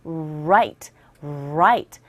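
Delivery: "right" started from an "oo" with rounded lips; low, slow "right", tongue touching nothing on the R. Shown as a demonstration. Teacher shows the English R, "right" led in by a rounded "oo". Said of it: "입 모양을 좀 동그랗게 하신 다음에 우".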